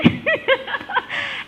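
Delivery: light airy laugh; real laugh at her own joke; high laugh. Real, not acted.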